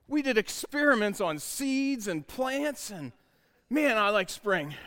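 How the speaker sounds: put-on a seven-year-old proudly explaining, drawn out; imitating an eager boy, slowed and lifted; excited young son. Son explains school experiments.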